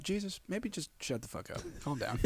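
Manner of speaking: very soft, discreet aside; hushed warning. Acted. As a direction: quietly warn a friend off. Discreetly warning Jesus in an acted scene.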